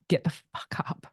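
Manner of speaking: slow, forceful whisper; hushed, intense order. Retold or imitated. Fed-up wife ordering husband up, slow intense whisper. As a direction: retell the order under your breath, word by word. Retold order at breaking point.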